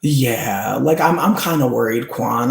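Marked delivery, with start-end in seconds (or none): none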